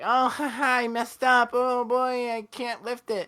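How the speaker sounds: put-on high giggly girl voice, laughing; girly giggly voice. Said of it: "Good voice".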